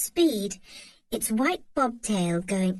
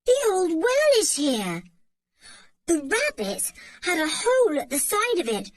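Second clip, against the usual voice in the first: high, rising excitement; excited discovery; 8 semitones higher, 3 dB louder than usual. A narrator's reading. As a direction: blurt out the discovery. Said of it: "He gave a shout of surprise".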